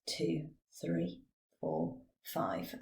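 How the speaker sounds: fading breathy count; breathing out. Shown as a demonstration. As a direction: let the count trail off on the out breath. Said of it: "and out".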